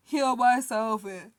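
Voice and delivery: words stretched by a yawn. Real, not acted. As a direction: talk through a yawn. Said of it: "I don't know why I keep yawning".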